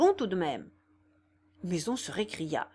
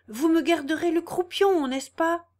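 higher and louder, pressing; insistent; 8 semitones higher, 5 dB louder than usual. A narrator's reading. Clémence begs the carver for the tailpiece, insistent and loud. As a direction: push for the tailpiece. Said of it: "avec insistance".

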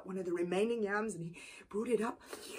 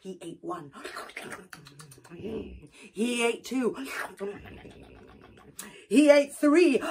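building count; 6 dB louder than usual. A narrator's reading. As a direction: build with each yam eaten.

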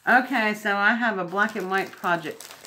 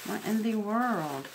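usual speaking voice

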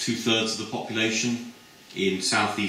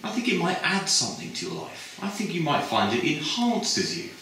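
high, hesitant and chatty; a lightly voiced girl fumbling for reasons; dreamy high sales pitch; gushing student; 9 semitones higher than usual. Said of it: "And her friend said".

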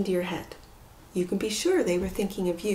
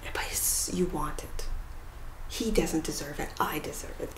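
a hushed, lightly voiced inner temptation; quiet, self-justifying inner voice; 5 semitones lower, 7 dB quieter than usual. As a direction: murmur it like the voice in your head talking you into it. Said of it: "these conversations in your head".

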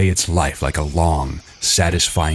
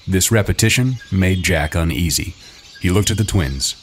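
plain reading voice

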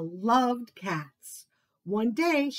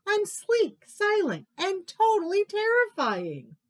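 high-pitched, insistent cub voice; little one puffing himself up; boastful little tiger; 10 semitones higher, 4 dB louder than usual. A narrator's reading. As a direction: insist proudly in a small high voice.

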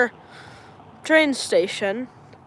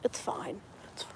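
whispering; 5 semitones lower, 19 dB quieter than usual. Real, not acted. Quiet reassurance to friend.

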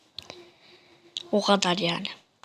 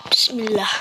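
whispered prayer; 3 semitones lower than usual. Real, not acted. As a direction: whisper the blessing. Whispered prayer before danger.

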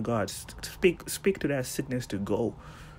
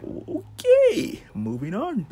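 high squeaky okay, moving on; mock awkward dismissal; 13 semitones higher, 4 dB louder, much slower than usual. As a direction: brush it off like nervous disciples. Disciples voiced, high.

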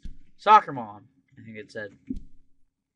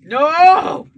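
anguished shout; 12 semitones higher, 14 dB louder than usual. Real, not acted. Losing in game.

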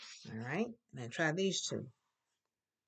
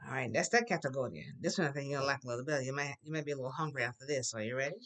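ordinary delivery

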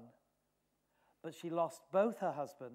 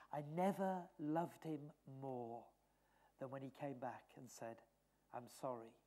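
tender memory; 4 semitones lower, 6 dB quieter than usual. Retold or imitated. Mother recalling her son tenderly.

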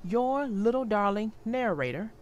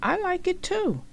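much higher, eager; eager agreement; 9 semitones higher than usual. A narrator's reading. Franklin to Rabbit, eager and high. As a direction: reassure a friend eagerly. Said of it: "asked Rabbit".

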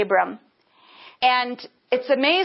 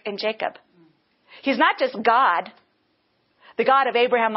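ordinary delivery

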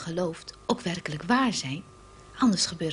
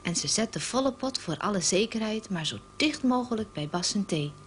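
ordinary talk

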